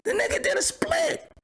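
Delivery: very high-pitched, disbelieving squeal; incredulous outburst. Real, not acted. Host reacts to a man doing the splits, incredulous outburst.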